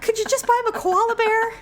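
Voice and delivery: high, imploring imitation of a parent; mock exasperated pleading. Pleading with dad over dangerous gifts, exasperated.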